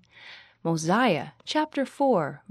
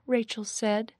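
plain reading voice

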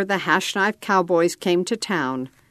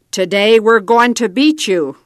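louder, bold challenge; loud declaration; 3 semitones higher, 7 dB louder than usual. A narrator's reading. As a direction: announce it like a dare to the rival team. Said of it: "Red declared".

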